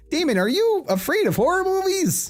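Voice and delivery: suddenly realizing, voice jumping up; rising surprise. Acted. Character realizes Damien's fear.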